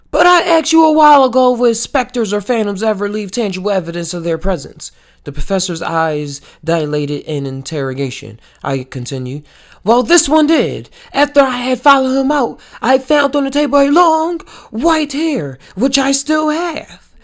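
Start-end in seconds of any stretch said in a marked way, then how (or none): 0.1-4.9 loud, fast and higher, pressing the point; excited. Acted. Narrator pressing the professor with mounting excitement. 9.8-17.1 high, loud and fast reveal; spring the proof on him, raised and quick; triumphant. Acted. Narrator triumphantly showing the professor his proof.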